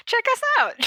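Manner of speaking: high, loud laughing plug; giggling shout-out to listeners. Real, not acted. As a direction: blurt it through laughter. Laughing self-promo call.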